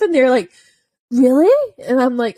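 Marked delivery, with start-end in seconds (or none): 1.1-1.7 higher, louder incredulous question; imitated disbelief. Trainer imitating clients hearing about her dog's past, incredulous. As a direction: ask it in exaggerated surprise, pitch shooting up.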